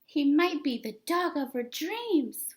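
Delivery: ordinary delivery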